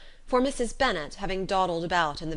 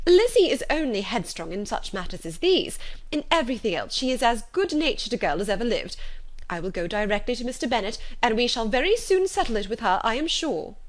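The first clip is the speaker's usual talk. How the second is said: louder, a mother anxiously smoothing things over; flustered mother; 4 semitones higher, 5 dB louder than usual. Acted. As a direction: insist it will all be settled. Mrs Bennet anxiously reassuring Collins.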